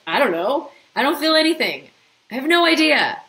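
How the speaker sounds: high, loud exasperated protest; frustrated blank. Retold or imitated. Newcomer checking in and finding nothing, loud exasperated. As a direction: play someone who can't feel anything. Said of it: "at first it's like".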